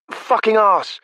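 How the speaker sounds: high, loud, savoring each word; delighted relish. Acted. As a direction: relish every word with glee. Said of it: "slow, delighted relish".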